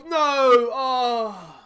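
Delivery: anguished shout. Real, not acted. Manager seeing his team concede, crying out in dismay. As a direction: yell as the shot goes in.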